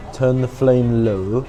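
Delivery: ordinary talk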